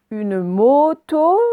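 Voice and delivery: "moto" slowly, both closed /o/ held; stretched O sounds. Shown as a demonstration. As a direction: dwell loudly on each O of "moto". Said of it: "là on l'entend deux fois". (« moto », les deux O allongés)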